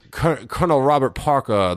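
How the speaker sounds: a light Colonel Parker impression; mock Southern colonel. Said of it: "One of those voices".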